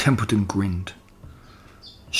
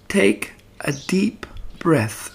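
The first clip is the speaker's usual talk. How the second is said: slow, gentle coaxing, with a smile; smiling; 6 semitones higher, 4 dB quieter, much slower than usual. Acted. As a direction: ease him into it kindly. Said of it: "said Charlotte, smiling".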